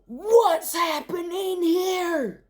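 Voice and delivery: the stacker angrily demanding; loud angry voice. Acted. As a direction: bellow it like a cross shopkeeper. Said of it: "a loud, angry voice said".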